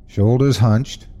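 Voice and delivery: usual speaking voice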